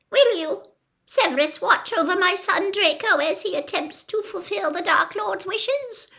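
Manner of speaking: high, quick, earnest; Narcissa, solemn plea. A narrator's reading. Narcissa recites the vow to Snape, high. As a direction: speak the vow urgently.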